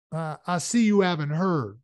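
ordinary delivery, nothing marked

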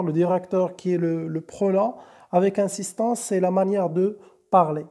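ordinary delivery

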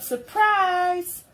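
high, loud happy yell; little bunny yelling surprise to Mama. Acted. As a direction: shout it out like a kid springing a surprise. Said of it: "He yelled".

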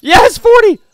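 very high, loud victory shout; real thrilled yell; triumphant cheer. Real, not acted. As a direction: whoop like you scored. Big hit in game.

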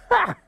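single high "ha!"; sharp high-pitched scoff; amazed laugh. Acted. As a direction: bark one high laugh of disbelief. Australian pigeon's amazed laugh.

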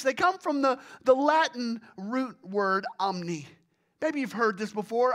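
normal talking voice

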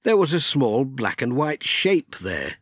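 ordinary delivery, nothing marked

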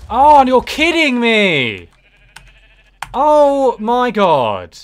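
loud, slow outraged yell; furious shout. Real, not acted. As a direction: yell it out in real fury. Loses all items in lava.

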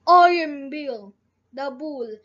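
loud, boastful bull voice; charging bull declaring himself boss; bull bragging loudly. A narrator's reading. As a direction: bellow it as the barnyard boss.

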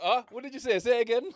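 loud, high, baffled asking; confused asking again. Retold or imitated. Talking to the barber, loud baffled asking to repeat.

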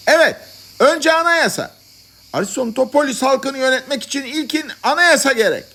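high-pitched shouts of agreement. A narrator's reading. Madmen at the back of the congress, shouting agreement. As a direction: call out from the crowd. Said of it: "Arkadan sesler yükseldi".

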